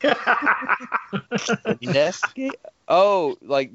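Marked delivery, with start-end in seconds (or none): 0.0-2.5 slow, laughing disbelief; incredulous laugh. Real, not acted. Puzzled by listener's wrong name.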